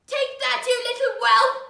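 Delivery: high, very loud raging father; furious shout. Acted. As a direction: yell it with each blow. Father beating his child.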